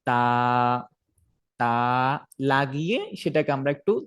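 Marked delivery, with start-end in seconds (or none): 0.1-1.7 তা slightly drawn out, tongue tip on the gum ridge; তা, lengthened. Shown as a demonstration. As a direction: stretch তা a little, tongue behind the teeth. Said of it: "যেমন".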